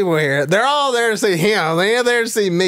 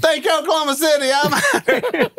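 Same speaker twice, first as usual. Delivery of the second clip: quick, much higher, tossed-off sign-off; excited stage exit; 8 semitones higher than usual. Retold or imitated. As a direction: drop the line and bolt off stage. Quick stage exit after joke.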